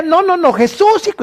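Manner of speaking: a very high, insistent plea; desperate cry. Retold or imitated. Blind man refusing the crowd, calling Jesus, desperate cry. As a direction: refuse to be silenced. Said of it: "Y él decía".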